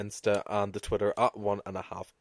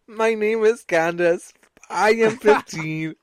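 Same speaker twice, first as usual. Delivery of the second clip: higher, slow, mock robot through laughter; imitating robotic voice; 10 semitones higher, 6 dB louder, slower than usual. Host copies her friend's creepy post, mock robotic voice. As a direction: copy the robot voice, giggling. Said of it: "the robotic voice".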